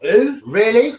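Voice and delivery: high, loud disbelieving yelp; shocked who. Retold or imitated. Retelling his shock at the name, high and loud. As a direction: act out being stunned by the name. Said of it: "I was like, who?".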